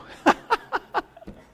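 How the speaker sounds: a quick high unvoiced laugh. Real, not acted. Pastor laughs at his own joke to the congregation, high and breathy.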